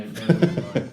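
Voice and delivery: laughing. Real, not acted. Laughing while vouching for story.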